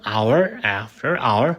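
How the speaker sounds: ordinary delivery, nothing marked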